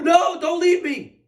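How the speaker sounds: high, drawn-out, loud begging; desperate plea. Acted. King grabs the departing prophet's robe, desperately pleading. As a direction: cry out to stop someone leaving.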